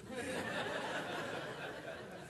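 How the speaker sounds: breathy, quiet laugh; soft laugh. Real, not acted. Pastor laughing at his own notes, quietly. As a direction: laugh softly at yourself.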